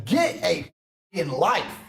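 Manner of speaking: ordinary talk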